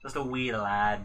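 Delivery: put-on Scottish accent, playful; Scottish accent, mocking a weak friend. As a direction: say it like a teasing Scotsman. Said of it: "We should probably stop the accents there".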